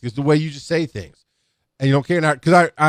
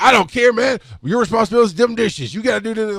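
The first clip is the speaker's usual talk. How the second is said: high, pushy mock scolding; retold angry insistence; 9 semitones higher, 3 dB louder than usual. Ronaldo overruling the narrator about dishes, retold angry insistence. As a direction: bark it high like an exasperated colleague.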